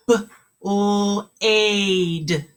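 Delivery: "T, er, ooth" said as three separate sounds with pauses; teacher segmenting "T, er, ooth" sound by sound. Shown as a demonstration. Teacher models sounding out a word for kindergartners, segmented. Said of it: "Make sure you're saying them".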